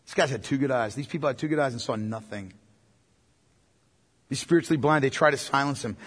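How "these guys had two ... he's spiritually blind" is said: ordinary delivery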